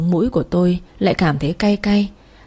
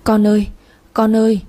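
anguished shout; 3 semitones higher than usual. Acted. Mother waking from a dream, shouting for her son. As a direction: shout the child's name in grief. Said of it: "mà hét tên gọi con".

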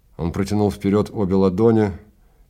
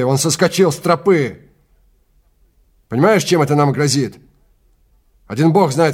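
rising, louder furious tirade; angry rant; 5 semitones higher, 4 dB louder than usual. Acted. Guide furious at the client's muddy boots. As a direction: berate them, voice climbing high. Travis rants about the bootprint.

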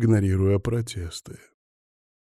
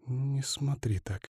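slow, very soft, higher female voice; whispered plea; 4 semitones higher, 10 dB quieter, slower than usual. A narrator's reading. Woman under Bogdan's gaze, whispering. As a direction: breathe it out slowly, barely audible. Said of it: "прошептала она".

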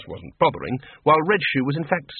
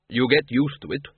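gloomy. A narrator's reading.

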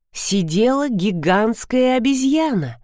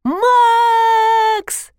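high, loud drawn-out scream; вопль ужаса; 5 dB louder than usual. A narrator's reading. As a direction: scream the name, stretching the vowel. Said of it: "из горла вылетел вопль". (истошный протяжный вопль)

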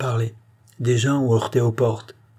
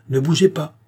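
raised, decisive order; general's commanding tone; 6 semitones higher, 4 dB louder than usual. A narrator's reading. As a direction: give a general's firm command. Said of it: "du ton d'un général". (ordre décisif de général)

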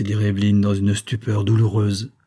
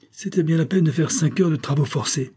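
mild angry exclamation, raised pitch; bitter outburst, higher; 6 semitones higher than usual. A narrator's reading. Neurologist rages at the nurses, mild raised pitch. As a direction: spit out the wasted effort, a touch angry. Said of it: "s'exclama rageusement le neurologiste".

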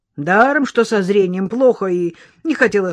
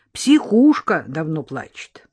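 usual speaking voice

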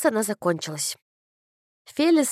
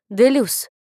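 sour, loud call-out; 6 dB louder than usual. A narrator's reading. Feely calls out Flavia's surname mid-hymn, sour and loud. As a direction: snap out the surname sourly. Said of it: "кисло произнёс голос".

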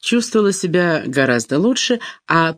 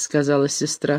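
plain reading voice

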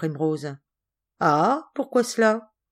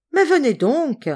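high, louder urging; impatient call; 9 semitones higher, 6 dB louder than usual. Acted. Cousin urging Georges to come and change, impatient call. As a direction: hurry the boy along impatiently.

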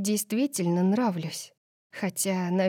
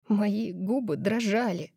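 ordinary delivery

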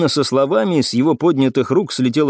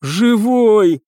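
higher, drawn-out glad cry; relieved exclamation; 8 semitones higher, 3 dB louder than usual. A narrator's reading. Cadet finds his comrade alive, relieved. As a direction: let the relief burst out. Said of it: "облегчённо воскликнул".

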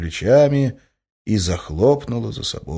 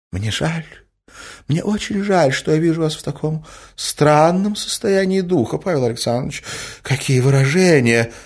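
higher-voiced, pitying lady; hostess feigning sympathy; 9 semitones higher, 3 dB louder than usual. A narrator's reading. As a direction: gently reproach him with wounded dignity. (сочувственно, с укором)

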